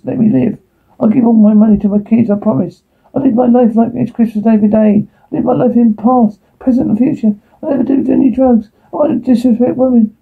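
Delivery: high-pitched frantic bargaining prayer; desperate pleading. Acted. Jack begs God to let him live, desperate pleading.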